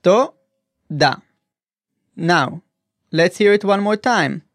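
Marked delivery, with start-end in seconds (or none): 0.0-1.2 To-da, each syllable said apart; split into syllables. Shown as a demonstration. Teacher breaking Toda into syllables, careful and slow. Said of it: "break it down by syllable".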